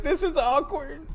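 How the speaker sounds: high, slow, strained delivery; mock movie quote. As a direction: draw it out in a high comic voice. Acts out stabbed character's line.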